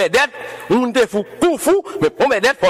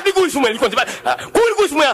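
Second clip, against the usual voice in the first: ordinary delivery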